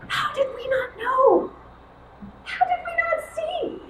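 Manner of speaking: two friends marvel at each other, high and amazed; amazed disbelief. Acted. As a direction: ask it stunned, almost breathless. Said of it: "looked at each other and said".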